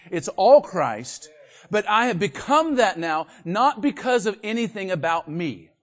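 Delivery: loud, high, fired-up preaching; excited preaching. Real, not acted. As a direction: preach it out with rising fervor. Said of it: "Sorry, I got a little excited".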